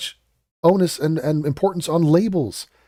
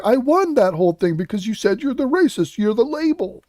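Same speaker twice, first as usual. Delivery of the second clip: higher, gloating mock-giddy voice; giddy schoolgirl; 6 semitones higher than usual. Commentator mocks the host as a gloating schoolgirl, giddy. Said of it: "like a little giddy schoolgirl".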